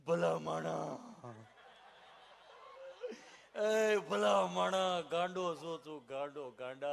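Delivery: a doctor's half-laughing rebuke; mock chiding. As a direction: call him a fool fondly. Doctor addresses patient, moved.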